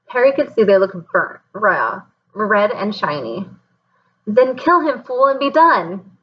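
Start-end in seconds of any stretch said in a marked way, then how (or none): 4.3-6.1 screeching. A narrator's reading. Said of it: "screeched Voldemort".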